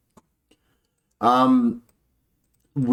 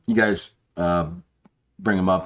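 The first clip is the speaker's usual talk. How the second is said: ordinary delivery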